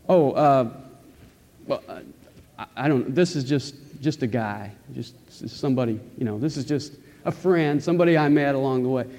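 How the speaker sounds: halting, fumbling for words, non-committal; hesitant, evasive. Acted. Jesus awkwardly introducing a mere acquaintance to the Father, hesitant. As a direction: hem and haw as if embarrassed to know someone. Jesus imagined barely acknowledging you.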